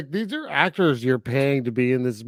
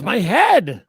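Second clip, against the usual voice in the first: higher, louder mock yell; imitated yell; 5 semitones higher, 7 dB louder than usual. Co-host mimicking the man being burned, mock yell. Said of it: "he just yells".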